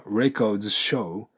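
very slow, "records show" blended with the s sounds merged into one; linked records show. Shown as a demonstration. Teacher slowing down to show the s linking. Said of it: "ここでのポイントは".

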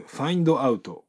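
"find out" in separate katakana syllables; unlinked Japanese-accented "find out"; katakana English. Shown as a demonstration. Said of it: "カタカナ英語だとfind outになります".

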